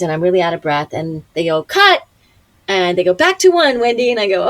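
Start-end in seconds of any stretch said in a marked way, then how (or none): 1.6-2.0 loud, high director's call; imitated set call. Crew ending a take, imitated loud call. 3.1-4.1 raised, higher calling voice; imitated set call. Crew sending the actress back to start, imitated calling out.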